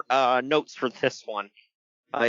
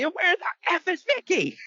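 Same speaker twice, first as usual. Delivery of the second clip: mock shout; slower than usual. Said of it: "my favorite part".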